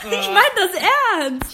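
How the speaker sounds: laughing high and loud; cracking up mid-sentence; loud helpless laughter. Real, not acted. Bursting into laughter mid-story.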